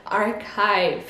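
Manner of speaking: archive very slowly, ch as K, I as a diphthong; slow English archive. Shown as a demonstration. Teacher slowly repeating archive. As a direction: stretch out archive as ar-kive. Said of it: "le C H devient un K et le I diphtongue".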